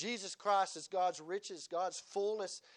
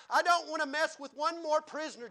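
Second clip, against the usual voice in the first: louder, higher weary protest; fed up; 9 semitones higher, 11 dB louder than usual. Acted. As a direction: complain like someone worn out and done. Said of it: "and you're tired".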